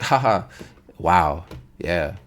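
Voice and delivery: low, passive acknowledgement, imitated; half-hearted low "uh-huh" reaction; noncommittal. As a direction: mimic a bystander just going along. Bystanders giving minimal replies to trash talk.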